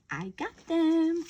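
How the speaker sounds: slow, smirking "I got them"; smug gloat. Retold or imitated. Gossiper gloating to herself, slow smirking voice. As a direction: savor the win, slow and sly. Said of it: "with a little smirk on their face going".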